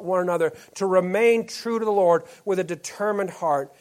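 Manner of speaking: rally them, full-voiced and urgent; preaching at full force. Pastor rallying members to encourage one another, full-force preaching. Closing exhortation to the church.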